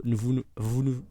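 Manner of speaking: stumbling over the words; tripping on every word; stutter. Real, not acted. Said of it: "merde, je bégaye".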